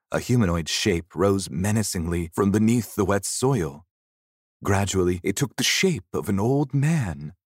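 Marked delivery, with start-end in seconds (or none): none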